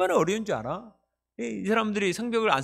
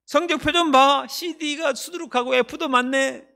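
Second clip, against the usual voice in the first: much higher, sneering mockery; Satan's accuser jeering higher and higher; taunting voice of Satan; 8 semitones higher than usual. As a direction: needle the listener's weak points with scorn. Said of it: "이렇게 조롱을 합니다".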